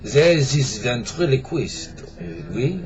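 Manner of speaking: put-on light comic French accent. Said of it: "start over with an accent".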